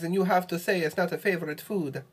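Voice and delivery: high, sing-song mock Italian accent. Player protesting the rule to a friend in a mock Italian accent. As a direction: put on a hammy Italian accent, pitched well up. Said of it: "speak with an Italian accent".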